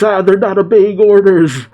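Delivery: high-pitched, alarmed mock shout; shouted dismay. A narrator's reading. Shaka sees the seraphim attack, high dismayed shout. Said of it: "As Shaka shouts".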